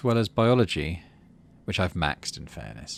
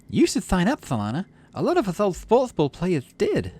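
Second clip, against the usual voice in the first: put-on incredulous girl; 11 semitones higher than usual. Girl doubting they still play sports ball, high incredulous voice. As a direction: play her amazed you mean it.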